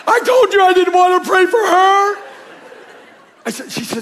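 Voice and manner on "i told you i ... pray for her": panicked thought. Retold or imitated.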